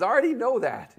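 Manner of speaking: put-on high, loud, impatient girl's voice; exasperated Alice. Alice snapping back at the cat, high and loud. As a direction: blurt it, fed up.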